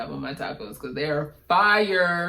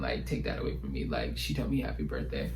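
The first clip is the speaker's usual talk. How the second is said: much lower, breathy, hushed and awed; whisper; 14 semitones lower, 7 dB quieter than usual. Real, not acted. Fan telling viewers about her idol's wish, awed whisper. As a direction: whisper it like a treasured secret.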